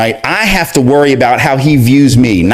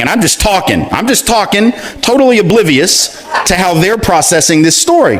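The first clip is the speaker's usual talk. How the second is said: ordinary talk, nothing marked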